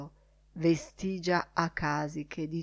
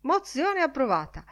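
higher-pitched call of a vote result; announcing; 7 semitones higher than usual. A narrator's reading. Lenihan declares the motion carried, announcing. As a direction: declare the vote like a meeting's chairman. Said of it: "annunciò Lenihan".

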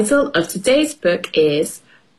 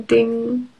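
usual speaking voice

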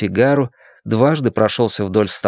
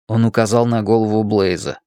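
ordinary delivery, nothing marked

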